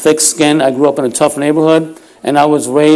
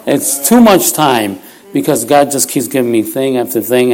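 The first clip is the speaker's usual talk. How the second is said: ordinary talk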